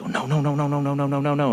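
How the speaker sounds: rapid, hushed string on one level note; mock panicked refusal. Retelling his cousin under the blanket, mildly panicked imitation. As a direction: imitate a scared kid's pleading, held back.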